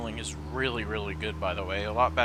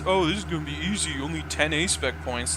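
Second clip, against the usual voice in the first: mock confidence; 5 semitones higher than usual. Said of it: "I say it's".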